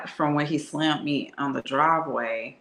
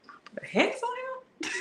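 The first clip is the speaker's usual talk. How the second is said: higher, whispered incredulous echo; mocking disbelief; 6 dB quieter than usual. Woman mocking his family's hex claim in disbelief. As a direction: repeat it like it's ridiculous. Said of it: "What are you saying?".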